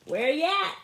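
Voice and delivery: loud playful hail; calling out. Acted. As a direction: shout it across the street. Brother calling out to him.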